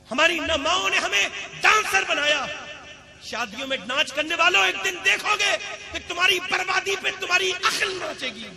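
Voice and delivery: loud, high-pitched rebuke from the pulpit; full-force preaching. Real, not acted. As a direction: cry out a stern warning to the crowd. (بلند آواز میں پرجوش تنبیہ)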